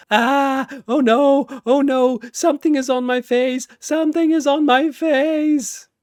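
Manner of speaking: shrill-free high, loud panicked shrieks, rushing; panicked screaming. A narrator's reading. Shy Guy screams as the facehugger grabs his face, high, loud panic. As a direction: scream in terror with something on your face.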